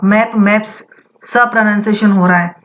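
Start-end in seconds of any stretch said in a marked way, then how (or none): 0.0-0.7 मैप्स ends in a crisp s; s plural ending. Shown as a demonstration. Teacher models plural for students, crisp s ending. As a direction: close मैप्स on a voiceless s. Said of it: "pronunciation सा होगा".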